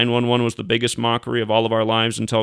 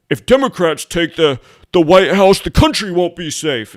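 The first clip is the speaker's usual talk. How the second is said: loud, higher mock campaign warning; mocking campaign scare; 6 semitones higher, 5 dB louder than usual. Mocking Republican campaign slogans with loud alarm. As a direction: ham up a fearmongering Republican. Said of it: "Republicans, when they were campaigning".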